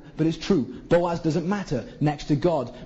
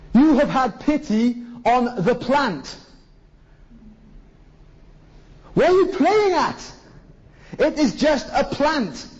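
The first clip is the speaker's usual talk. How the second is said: much higher, louder incredulous rebuke; God rebuking; 8 semitones higher, 3 dB louder than usual. Retold or imitated. God rebuking Jonah over the plant, loud incredulous scolding.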